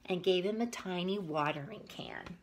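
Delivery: put-on reading a picture book aloud to kids; story narration. A narrator's reading. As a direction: tell it gently to little listeners.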